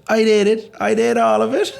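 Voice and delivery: high, loud mimicked Cork brag; hammed-up, squealed Cork lilt; Cork lad's catcall. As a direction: call out in a put-on high Cork voice. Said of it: "he goes".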